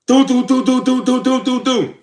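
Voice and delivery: high, loud knock-knock sounds; imitated door knocking. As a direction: tap out the police knocking with your voice.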